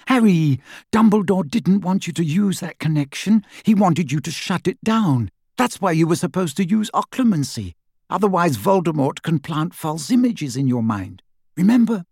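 high, insistent, nagging; anxious Hermione. A narrator's reading. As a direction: press the point, high and urgent.

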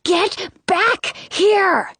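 high, slow, strained hushed yell; whisper-yell. A narrator's reading. Sister ordering brother back, whisper-yelling. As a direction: punch each word under a hush. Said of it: "I whisper yell".

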